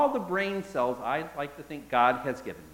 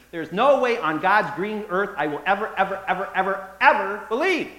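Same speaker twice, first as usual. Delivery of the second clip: louder, hammering each ever; emphatic refusal; 3 semitones higher, 7 dB louder than usual. Acted. Thomas insisting he never will, stressed repetition. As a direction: pound every ever home. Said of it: "the Greek there can be translated with".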